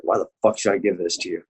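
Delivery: ordinary talk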